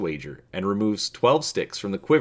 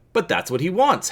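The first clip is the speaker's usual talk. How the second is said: a quick sharp retort, pitched higher; snapping; 8 semitones higher than usual. A narrator's reading. Han snaps at the droid taking her bet, sharp and quick. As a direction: bite the words off in protest. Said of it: "Han snaps".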